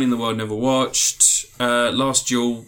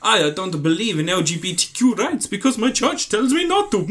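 mock Chris Pratt voice; 7 semitones higher than usual.

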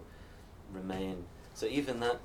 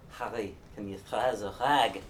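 put-on old rural Northumberland accent with burred Rs; louder, playful Northumbrian burr on every R; 5 dB louder than usual. Neighbour asking dad for a rag as a joke, Northumbrian burr. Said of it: "Ad Michael did this".

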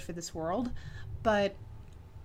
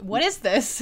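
amazed disbelief; 3 semitones higher, 11 dB louder, slower than usual. Real, not acted. Amazed at budgeted Tales game.

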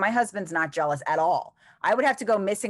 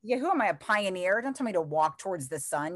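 normal talking voice

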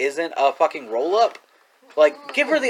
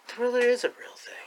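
laughing; 5 semitones higher, 9 dB quieter than usual. Real, not acted. Laughing shout.

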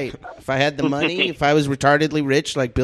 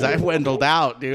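usual speaking voice

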